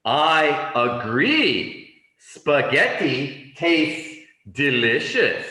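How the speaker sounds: slow, loud, measured model sentence; emphatic agreement. Acted. Teacher models agreeing about spaghetti for learners, slow and emphatic.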